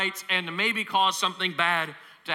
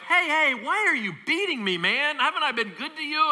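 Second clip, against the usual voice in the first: much higher, hurt and pleading animal voice; the donkey protests its beating in a high squeaky voice; talking donkey; 10 semitones higher than usual. Retold or imitated. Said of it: "And the donkey's like".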